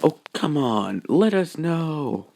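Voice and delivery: slow, mock-pouty coaxing. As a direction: whine it slowly like a pouty girl. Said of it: "in her pouty voice".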